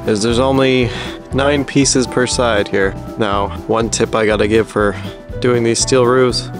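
usual speaking voice

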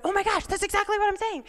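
high, fast gushing; retold excited outburst. Speaker quoting her thrilled friend, high and fast. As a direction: imitate a friend bursting with agreement. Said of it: "And she's going".

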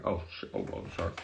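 low, soft embarrassed apology; hushed. Real, not acted. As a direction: catch yourself quietly. Catching himself after swearing.

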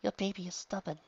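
put-on a frail young mother speaking; weak woman's voice. A narrator's reading.

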